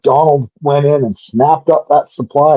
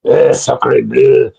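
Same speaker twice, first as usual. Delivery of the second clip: put-on French accent. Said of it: "I am a quarter French, so I'm allowed to do this".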